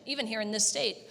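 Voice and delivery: ordinary talk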